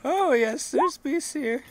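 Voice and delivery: put-on high-pitched strange old farmer; squeaky rural farmer, eager; odd farmer voice.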